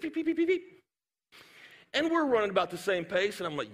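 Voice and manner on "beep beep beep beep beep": high rapid electronic beeps; treadmill beeps. Retold or imitated. Preacher mimicking a girl starting up her treadmill, high beeping. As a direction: imitate a treadmill's buttons, high and quick. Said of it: "she starts her beep".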